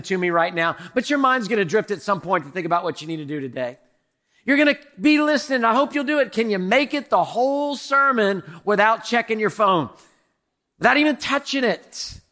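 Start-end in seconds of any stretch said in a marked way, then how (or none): none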